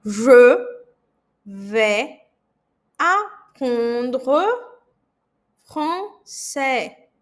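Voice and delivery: "Je vais apprendre français" palavra por palavra, com pausas; slow, word by word. Shown as a demonstration. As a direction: diga devagar para o aluno repetir. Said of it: "Eu vou fazer bem pausadamente". (bem pausadamente, palavra por palavra)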